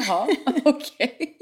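slow, loud and high, laughing through it; a slow laughing "okay, then"; laughing disbelief. Retold or imitated. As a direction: laugh in disbelief at his answer. Reacting to bikini answer.

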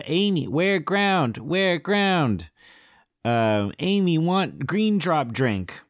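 high, drawn-out ape voice; talking gorilla. Acted. Gorilla interrupting the argument with demands, high gorilla voice.

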